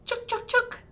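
high, loud chittering chuk sounds; squirrel chittering. Shown as a demonstration. Storyteller voicing the mama squirrel, high loud chitter. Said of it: "Can you do that?".

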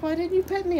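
lightly voiced complaining dog; voicing the dog. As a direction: speak for the pet, pitched high. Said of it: "He's like".

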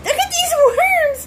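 excitable panicky cry; scared. Acted. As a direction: raise the alarm in acted fright. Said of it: "I'm so scared".

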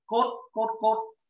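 "Code" with a crisp, clearly voiced k; clear k sound. Shown as a demonstration. Teacher modelling "Code" with a crisp k. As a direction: spell out the k in "Code" plainly. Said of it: "Đọc rõ chữ cờ ra".

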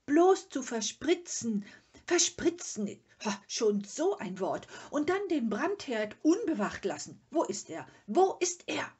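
loud, high, scandalised rant; outraged calling out. A narrator's reading. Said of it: "rief der Vater". (laut und empört polternd)

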